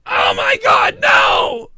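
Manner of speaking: very high, loud mock scream; horrified trainer. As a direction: scream in exaggerated horror. Acted trainer horrified at Gatorade.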